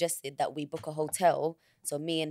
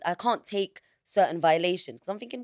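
ordinary talk

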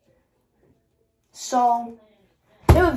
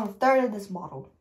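usual speaking voice

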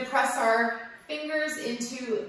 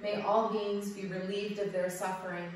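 softer, lower prayerful close to class; closing dedication; 3 semitones lower, 5 dB quieter than usual. Acted. Yoga teacher ends the class with a soft prayerful dedication. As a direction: offer the merits quietly, like a blessing.

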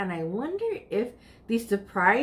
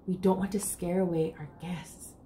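hushed; 5 semitones lower, 7 dB quieter than usual. A narrator's reading. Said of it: "Aunt Louisa whispers".

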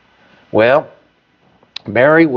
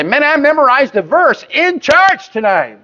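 much higher, mock boastful voice; proud churchgoer; 10 semitones higher than usual. Congregant bragging to family at home, higher mock pride. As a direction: brag like a kid showing off. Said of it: "and you say".

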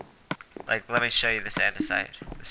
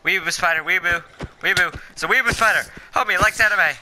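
panicked shrieking, high and loud; screaming at a spider; 8 semitones higher, 5 dB louder than usual. Real, not acted.

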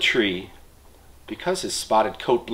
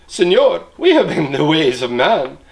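higher, louder, excited monkey chatter; a troop of monkeys pleading, high and loud; 7 semitones higher, 4 dB louder than usual. Acted. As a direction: chatter away, climbing higher as they warn. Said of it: "They chattered to the sleeping man".

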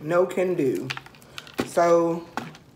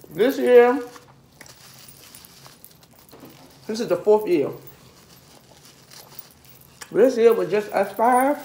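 chewing between words; talking while eating bread; mouth full. Real, not acted.

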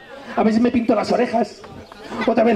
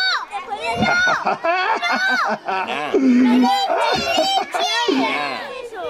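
high laughing burst. Real, not acted. Laughs at kids' reaction.

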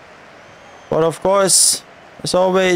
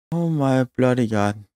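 deflated disbelief; 7 semitones lower, 5 dB quieter than usual. Real, not acted. Fan after the penalty is denied, quiet despairing groan. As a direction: lower and softer, drained.

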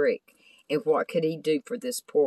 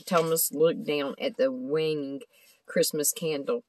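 ordinary delivery, nothing marked

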